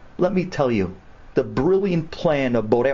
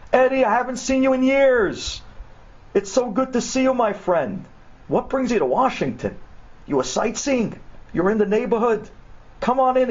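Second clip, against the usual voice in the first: high-pitched, joyful welcome; thrilled greeting; 10 semitones higher than usual. Acted. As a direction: greet a long-lost friend with delight. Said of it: "He was thrilled".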